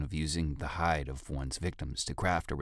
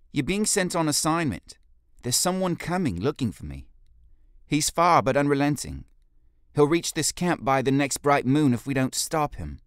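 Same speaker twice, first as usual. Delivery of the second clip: much higher, louder stern orders; commanding leader; 10 semitones higher, 8 dB louder than usual. Acted. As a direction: command the guard to go. Jolie gives orders.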